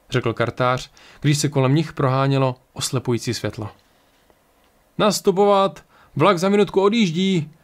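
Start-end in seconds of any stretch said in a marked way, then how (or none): 5.0-7.5 high-pitched train dispatcher's call; raised, projected boarding announcement. A narrator's reading. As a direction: call passengers aboard from along the platform. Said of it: "volal výpravčí".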